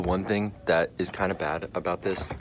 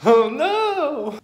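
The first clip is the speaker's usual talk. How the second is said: dismayed cry; 9 dB louder than usual. Real, not acted. Reacts to the purple verdict.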